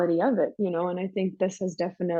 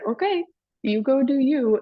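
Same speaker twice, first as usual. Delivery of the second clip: higher, louder mock okay; friends' send-off; 6 semitones higher, 5 dB louder than usual. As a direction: lightly imitate friends waving someone off. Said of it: "And they're like".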